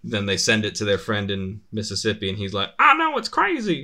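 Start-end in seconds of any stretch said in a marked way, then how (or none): 2.8-3.8 very high, loud caricature Southern twang; mock Southern accent. Friend in Mississippi reacting to the map, excited mock Southern accent.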